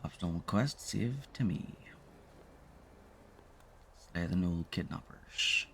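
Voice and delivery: ordinary talk, nothing marked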